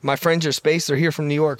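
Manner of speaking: usual speaking voice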